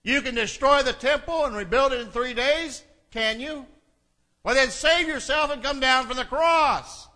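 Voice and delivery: loud, high taunting challenge; yelled mockery. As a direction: call out a jeering dare to the victim. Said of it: "they yelled at him".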